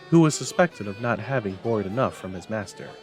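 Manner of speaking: ordinary delivery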